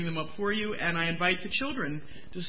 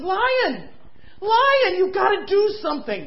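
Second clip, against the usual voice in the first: excited monkey; 13 semitones higher, 8 dB louder than usual. Acted. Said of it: "came running up and he said".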